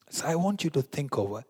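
ordinary delivery

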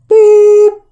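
loud high boop tone; high beep tone. Pretend. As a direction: sing the keypad tone high. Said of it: "What's the tone sound for two?".

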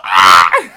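very loud low bellow; silly full-volume scream; mock scream. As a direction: cap the bit with a blaring "ah". Goofy scream in the bit.

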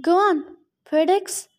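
ordinary delivery

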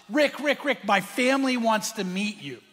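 high, loud eager rush; acted gleeful urgency; excited. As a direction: call out bursting with news. Anna excitedly greets Rick, retold.

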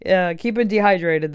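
usual speaking voice

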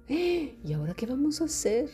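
gasp, then a high, mock-helpless whine; exaggerated helpless lament; mock panic. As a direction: fret in a hammed-up high voice. Acted panicked lament, after a gasp.

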